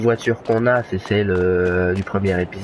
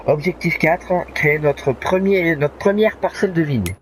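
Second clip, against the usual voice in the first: announcing; 8 semitones higher than usual. Real, not acted. Announcing the next objective.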